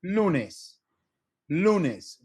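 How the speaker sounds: "lunes" with LU high, falling away on nes; front stress. Shown as a demonstration. Teacher repeating the example, LU in "lunes" stressed. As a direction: stress the first syllable of "lunes" each time. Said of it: "more emphasis on the front end".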